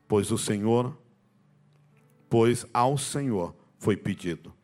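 loud, slow, solemn Bible reading; scripture reading. Acted. Preacher reads Hannah's words from Samuel to the church, loud and solemn. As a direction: read the verse out with weight.

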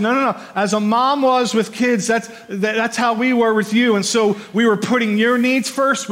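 fervent sermon voice; full-force preaching. Acted. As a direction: lift the room with it from the pulpit. Preaching Paul's nursing-mother picture.

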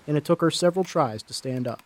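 ordinary delivery

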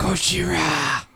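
Gojira said slowly, Japanese style, go-ji-ra; Japanese pronunciation. Shown as a demonstration. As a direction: draw out Gojira like the Japanese name. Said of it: "that's how they say it in Japan".

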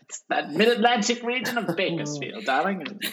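a hint of a grand mid-Atlantic diva; mock mid-Atlantic accent. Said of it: "that voice is from Fresno".

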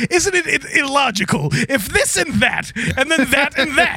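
mock robot freak-out. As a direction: sputter like a flustered android. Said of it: "robot freaking out".

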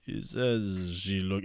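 put-on slow Bulgarian accent; drawn-out Bulgarian-accented English. Bulgarian guy describing the wife, slow Bulgarian accent. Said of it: "the Bulgarian guy keeps going".

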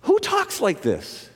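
high-pitched rhetorical disbelief; high incredulous question, falling at the end. Real, not acted. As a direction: ask it high, marvelling at the claim. Rhetorical disbelief at bold claim.

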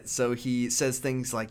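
ordinary talk

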